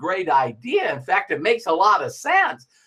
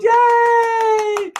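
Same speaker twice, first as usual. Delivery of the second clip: much higher, louder cheer; cheering yay; 6 dB louder than usual. Retold or imitated. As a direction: cheer like a proud crowd. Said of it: "then everybody goes".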